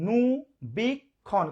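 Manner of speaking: অনুবীক্ষণ slowly as "o - nu - bik - khon"; four separate beats on অনুবীক্ষণ, pausing between; syllable by syllable. Shown as a demonstration. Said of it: "তাহলে উচ্চারণ করি". (অনুবীক্ষণ চার ভাগে, থেমে থেমে)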